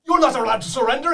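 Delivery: high, loud boss yell; high screeching villain forbidding surrender; angry boss. Acted. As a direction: scream the order at the kobolds. Boss yells at kobolds.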